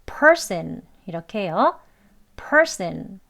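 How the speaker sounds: person with per hit hard and the rest light; stress on per. Shown as a demonstration. Teacher shows stress on the first syllable of person. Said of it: "앞에 음절을 강하게 발음하란 뜻".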